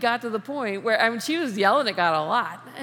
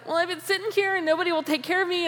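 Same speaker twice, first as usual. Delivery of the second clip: whiny excuse; 8 semitones higher, faster than usual. Retold or imitated. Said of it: "the guy's like".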